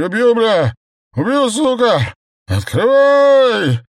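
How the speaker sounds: put-on very high, loud, long-drawn drunken scream; furious threatening scream. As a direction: scream threats while charging the door. Hurls himself at door, threatening. (яростный пьяный вопль)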